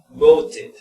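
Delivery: loud "voted" with the ending like "tid"; "voted" pronounced emphatically, ending sounded; extra-syllable ending. Shown as a demonstration. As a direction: model "voted" in two clear syllables. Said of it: "en T y en D se pronuncian como it".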